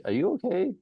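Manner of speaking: high, light concerned question; wife asking. Retold or imitated. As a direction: voice her gentle check-in. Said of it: "she's like".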